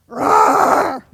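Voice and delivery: tiger roar. Shown as a demonstration. Speaker leading the audience in being tigers, playful roar. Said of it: "now we're gonna be tigers".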